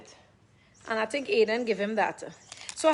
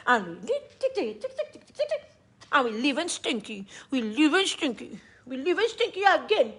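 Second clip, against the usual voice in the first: teasing sing-song. Real, not acted.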